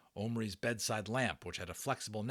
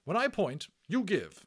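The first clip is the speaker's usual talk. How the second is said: high, loud, simple words to a foreigner; 10 semitones higher, 8 dB louder than usual. Acted. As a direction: spell it out like a nurse. Matron instructing simply.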